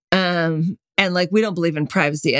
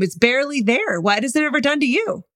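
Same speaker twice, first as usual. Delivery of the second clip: usual speaking voice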